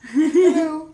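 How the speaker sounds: put-on loud, high, laughing child voice; imitated child shouting hello; child's hello. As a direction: pipe it back like a delighted toddler. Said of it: "And child went".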